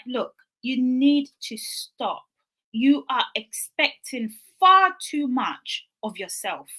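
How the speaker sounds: slow, emphatic telling-off; firm friend's advice. Retold or imitated. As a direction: spell it out slowly and firmly.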